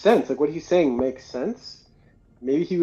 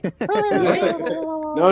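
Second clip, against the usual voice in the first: imitating Yoshi; 4 dB louder, slower than usual.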